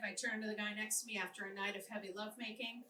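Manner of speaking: ordinary delivery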